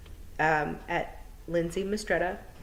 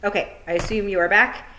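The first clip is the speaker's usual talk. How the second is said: plain reading voice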